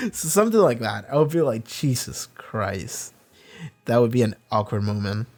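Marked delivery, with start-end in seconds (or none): 1.7-3.1 slow, soft exhaled disbelief; a quiet drawn-out groan of dismay; stunned dismay. Retold or imitated. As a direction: let it drag out under your breath. Reacting to awkward moment.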